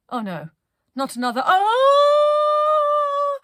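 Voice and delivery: rising to a long high shriek; crew's dismay. Acted. The crew dread another cry, rising to a shriek. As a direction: dread becoming a scream. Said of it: "they all thought".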